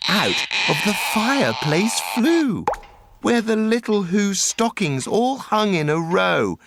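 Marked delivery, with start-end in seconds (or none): none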